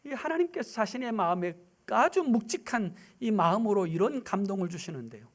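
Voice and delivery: usual speaking voice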